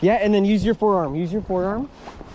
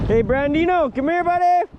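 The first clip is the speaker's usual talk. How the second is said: ordinary delivery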